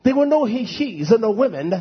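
ordinary delivery

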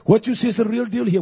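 normal talking voice

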